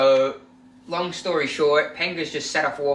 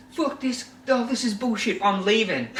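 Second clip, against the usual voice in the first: higher, hammed-up huff, laughing through it; mock storming off; 8 semitones higher than usual. Penga storming off training after the scuffle, mock huff. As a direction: play a player quitting in a strop.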